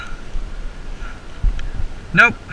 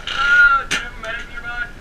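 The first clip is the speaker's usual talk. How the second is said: ordinary talk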